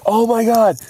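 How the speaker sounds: high, loud horrified wail; panicked cry. Retold or imitated. Driver puked on in parents' car, high panicked cry. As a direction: cry out in hammed-up horror.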